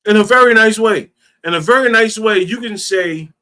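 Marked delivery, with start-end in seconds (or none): 0.1-1.1 louder and higher, biting; loud and sharp. Shown as a demonstration. Host demonstrating a rude tone to the caller, loud and sharp. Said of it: "you can change the tone". 1.4-2.4 louder and higher, biting; loud and sharp. Shown as a demonstration. Host demonstrating a rude tone to the caller, loud and sharp. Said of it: "you can change the tone".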